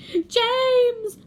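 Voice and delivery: high, loud bawl; bawled name. A narrator's reading. Centipede calling James for rescue, high loud bawl. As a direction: bawl for help. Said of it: "bawled the centipede".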